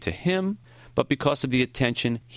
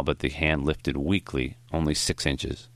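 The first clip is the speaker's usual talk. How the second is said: usual speaking voice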